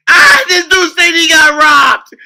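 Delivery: high, loud words broken by laughter; laughing shout. Real, not acted. Mocking Klay to viewers, laughing loudly. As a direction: crack up while shouting it out.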